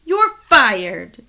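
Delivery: loud shouted firing; mock firing shout. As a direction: bellow it like a fed-up boss. Firing the art cop.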